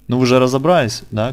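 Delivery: ordinary talk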